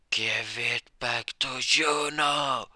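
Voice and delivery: loud, high, drawn-out yell; acted scream. Rescuer yells at the drunk bullying Juno, full-voiced scream. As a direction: scream it slowly, stretching the last word. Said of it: "he hears a scream say".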